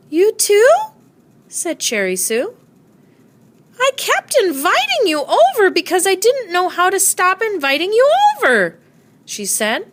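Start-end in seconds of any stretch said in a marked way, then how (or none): 0.1-0.7 surprised. A narrator's reading. Neighbor surprised by confession. 3.8-8.8 neighbor's voice. A narrator's reading.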